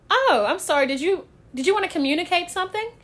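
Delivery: normal talking voice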